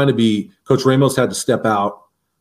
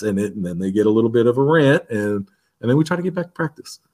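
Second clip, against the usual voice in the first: ordinary delivery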